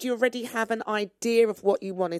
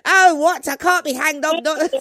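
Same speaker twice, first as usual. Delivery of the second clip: moaning; 7 semitones higher, 8 dB louder than usual. Pretend.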